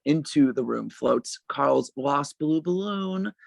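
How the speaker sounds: normal talking voice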